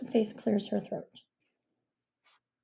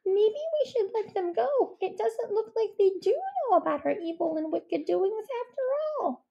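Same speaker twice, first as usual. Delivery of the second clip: high badger voice; 12 semitones higher than usual. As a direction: play a timid badger, high and careful. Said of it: "said Stuart the Badger".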